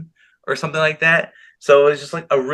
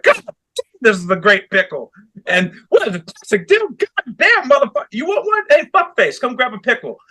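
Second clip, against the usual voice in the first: high, hammed-up yelling about a pickle, much higher; hammed-up heel yelling; 10 semitones higher than usual. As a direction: bellow it like an unhinged heel with a pickle. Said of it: "screaming about how great the pickle is".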